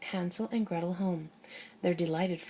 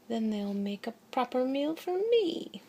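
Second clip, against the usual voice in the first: higher, put-on witch voice; 5 semitones higher than usual. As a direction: voice the wicked witch. Parent voicing witch in story.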